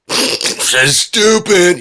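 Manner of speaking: high, loud laughing jeer; mocking laugh. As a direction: laugh it out in drawn-out ridicule. Mimicking someone laughing at the word.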